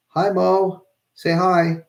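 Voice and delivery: high sweet pet-talk voice; talking to the dog. Real, not acted. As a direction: coo at a tiny pup. Talking to his small dog again.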